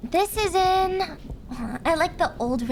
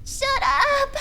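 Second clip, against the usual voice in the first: loud, high-pitched yell; attempted scream; 13 semitones higher, 5 dB louder than usual. Practicing at screaming for the listeners, attempted yell. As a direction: try out a scream, pitch shooting up. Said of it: "I don't know how to scream".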